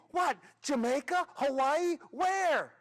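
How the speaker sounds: escalating excited demands; eager guessing. Acted. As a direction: shout out guesses, bursting with anticipation. Kids shout eager guesses.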